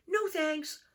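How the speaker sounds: put-on a busy child turning down a snack; Franklin's child voice. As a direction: toss it off high and quick. Franklin answering mother.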